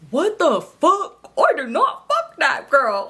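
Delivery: slow, high hammed-up denial; mock outraged denial. Cousin reacting to screenshots, slow hammed-up outrage. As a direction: drag out each word in put-on shock.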